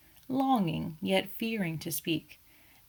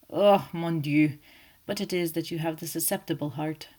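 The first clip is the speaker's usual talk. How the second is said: groaning Poirot; 5 dB louder than usual. A narrator's reading. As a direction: lament it, mock-suffering. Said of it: "Poirot groaned".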